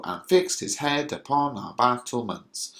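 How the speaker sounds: sing-song beat on "fixed", "head", "battlements"; stressed iambic beat. Shown as a demonstration. Teacher demonstrating meter on "and fixed his head upon our battlements", alternating stresses. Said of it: "the emphasis is on uh, every other syllable".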